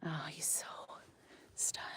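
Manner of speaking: soft breathy whisper to viewers; whispered aside. Real, not acted. Owner whispering to viewers about her stunned alpaca, hushed whisper. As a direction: hush it so he stays calm.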